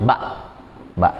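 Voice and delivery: "Ba" closed with a glottal stop like an apostrophe; slightly pressed ending. Shown as a demonstration. Teacher recapping silent hamzah, ba slightly pressed. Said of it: "Agak ditekan".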